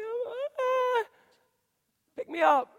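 put-on high, pleading baby whimper; hammed-up infant plea; whiny baby voice. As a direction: beg like a baby to be picked up.

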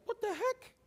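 very high, hushed disbelief; afraid disciples. Acted. Disciples stunned as the storm stops, afraid. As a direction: squeak it in mock awe and fear. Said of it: "They were more afraid".

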